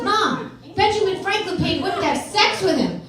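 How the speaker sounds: high, loud breaking news; acted excited child. Daughter running back to mom with a discovery, excited. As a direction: blurt it out like a kid reporting a scandal.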